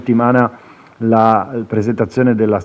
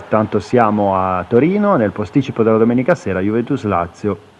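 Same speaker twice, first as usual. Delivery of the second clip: usual speaking voice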